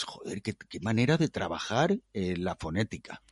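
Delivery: near-whispered amazed mutter; awed half-whisper to himself; hushed aside. Retold or imitated. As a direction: drop to a breathy hush as if to yourself.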